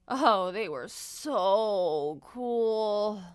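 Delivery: very slow, dragged-out admiring sigh; so cool it hurts; awed drawl. Acted. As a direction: stretch it out, dazzled by them. Narrator gushes over cool beans.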